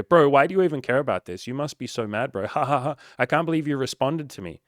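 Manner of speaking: loud jeering troll laughing at him; mocking troll. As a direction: taunt him, cackling. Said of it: "they go".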